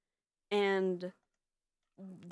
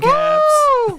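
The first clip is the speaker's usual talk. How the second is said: cheering shout; 18 dB louder than usual. Real, not acted. Cheering after the finale.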